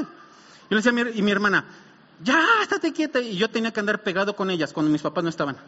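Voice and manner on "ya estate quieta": mock exasperated scolding. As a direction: imitate an annoyed "stop it". Acts exasperated scolding between siblings.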